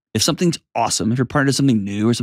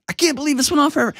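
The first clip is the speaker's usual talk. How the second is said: high, fast embarrassed disbelief; embarrassed wife; 13 semitones higher, much faster than usual. Retold or imitated. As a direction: rush it out, mortified and high. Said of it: "she was embarrassed like crazy".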